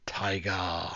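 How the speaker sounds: breathy whispered tiger; hushed, reverent whisper. Real, not acted. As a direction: drop to a whisper on the last word. Hushed end of praise list.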